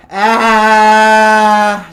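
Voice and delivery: loud short ah from the belly; saying ahh. Shown as a demonstration. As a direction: blast out a quick ah. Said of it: "a lower-ish sound".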